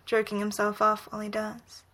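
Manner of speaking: ordinary delivery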